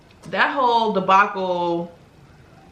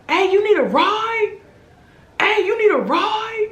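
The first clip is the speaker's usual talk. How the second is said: much higher, louder hammed-up come-on; mock stranger's call; 13 semitones higher, 3 dB louder than usual. Driver calling out to her from his window, high hammed-up holler. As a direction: imitate a guy hollering from a car.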